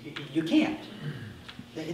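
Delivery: normal talking voice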